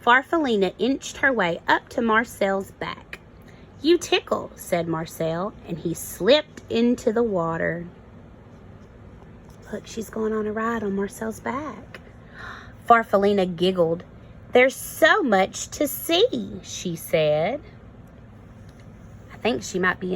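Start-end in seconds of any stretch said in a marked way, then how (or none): 3.8-4.5 much higher, ticklish bird voice; lightly voiced tickled bird. A narrator's reading. Bird laughing while tickled. 14.5-16.6 higher, slow and wondering; a touch of wide-eyed wonder; delighted caterpillar. A narrator's reading.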